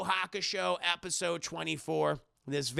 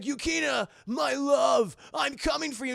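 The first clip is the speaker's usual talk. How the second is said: higher, loud dramatic love call; lovestruck shout; 7 semitones higher, 3 dB louder than usual. Retold or imitated. Kuwabara shouting telepathically to Yukina, hammed-up love call. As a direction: call out to her like a hammed-up hero. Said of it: "And Kuwabara's like".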